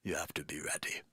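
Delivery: low, hushed, near-whispered theatrical voice; Jeremy Irons impression. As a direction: breathe it out like a brooding stage actor. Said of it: "The Jeremy Irons".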